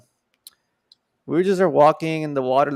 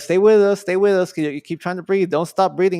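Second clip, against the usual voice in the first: higher, louder urgent plea; urgent paramedic shout; 4 semitones higher, 6 dB louder than usual. Retold or imitated. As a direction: urge him to stay awake, loudly. Paramedics urging him.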